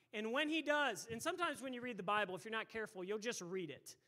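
ordinary delivery

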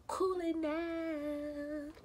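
slow, stretched-out cool it down; drawn out. Acted. Telling the driver to calm down, slow and stretched. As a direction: drag it out like a warning.

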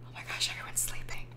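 hushed unvoiced whisper; quick excited whisper. Real, not acted. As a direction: whisper so the babies stay asleep. Babies finally asleep.

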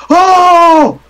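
high, loud cry of panic; panicked scream. Acted. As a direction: cry out in alarm, voice shooting up. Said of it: "he screams".

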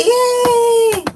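a high, joyful shout of yay; excited yay. Real, not acted. Cheering for Sissy's birthday.

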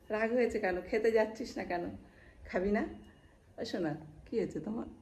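ordinary talk, nothing marked

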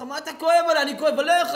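very loud, high, half laughing; mock shouting friend. Friend yelling back at the rabbi, high mock shout. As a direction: yell it back mockingly.

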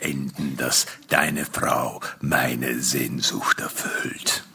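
very low, slow, unruffled taunt; stranger's deep voice. Acted. Stranger taunting the struggling husband, deep slow voice. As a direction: taunt him slowly from deep down.

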